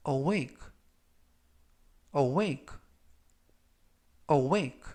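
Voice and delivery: awake said slowly and clearly; slow model reading. Shown as a demonstration. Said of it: "제가 천천히 세 번씩 읽어드리고요".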